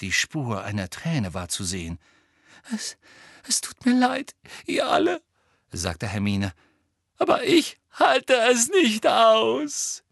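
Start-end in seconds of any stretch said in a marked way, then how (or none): 2.7-10.0 high, cracking, hammed-up sobs; fake sobbing. A narrator's reading. Hermine pretending to break down before Umbridge. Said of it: "schluchzte Hermine verzweifelt".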